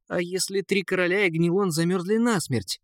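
high-pitched, anxious prince; worried prince. A narrator's reading. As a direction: ask it high, with a touch of alarm. Prince fears kings froze. (встревоженно, высоким голосом)

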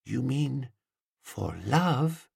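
soft, hushed wonder; whispery. A narrator's reading. Woman grasping the hint about love, whispery. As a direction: ask it in a near-whisper. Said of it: "Her voice, when she spoke, was whispery".

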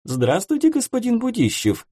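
very high, twittering voices; chirping reply. Acted. Seamstresses chirping hello to Dmitry, very high. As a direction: chirp it back like a flock of girls. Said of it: "прощебетали те".

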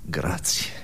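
whispered, deflated thanks. Acted. Gandhi thanking the man who praised him, beaten whisper.

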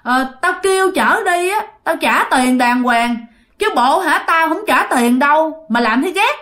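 hammed-up cranky complaint; irritated, demanding. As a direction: berate the boatwoman like a huffy customer. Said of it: "cái giọng bực bội, cái giọng hạch sách".

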